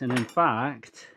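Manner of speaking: usual speaking voice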